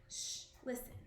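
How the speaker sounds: whispered, breathless hush. A narrator's reading. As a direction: hush them so she won't wake. Hushing to watch sleepwalker.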